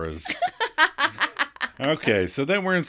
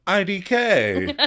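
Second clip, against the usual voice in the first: Phoebe voice; 8 semitones higher, slower than usual. Retold or imitated. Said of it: "And Phoebe is like".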